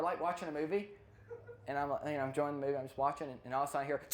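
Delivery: ordinary delivery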